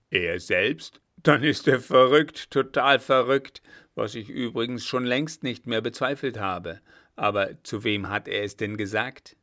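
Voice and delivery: loud outburst easing into questioning; indignant old marshal. Acted. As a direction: scoff loudly, then get down to business. Blücher reacts to the emperor's boast. (empört polternder alter Feldmarschall)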